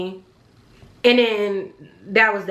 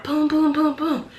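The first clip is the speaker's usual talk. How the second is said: higher-pitched knocking noise in words; imitated knocking; 5 semitones higher, slower than usual. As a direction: punch out even booms like knocks on a gate. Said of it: "I start knocking on the".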